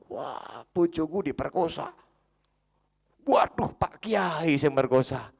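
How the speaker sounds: high, loud cry of shock; acted husband's scandalised yell; alarmed outcry. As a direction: raise the alarm as the horrified husband.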